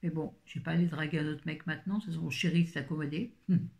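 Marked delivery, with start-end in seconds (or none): none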